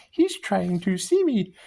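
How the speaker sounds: a hint of a little sprite; strange fairy voice. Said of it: "I hear a strange fairy voice".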